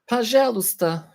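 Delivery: "Пожалуйста" hoog en afgemeten als een computerstem; imitating Google Translate. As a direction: doe de stem van Google Translate na. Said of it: "says Google Translate".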